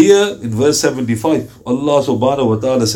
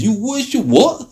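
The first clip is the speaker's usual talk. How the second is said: incredulous question; 9 semitones higher, slower than usual. Retold or imitated.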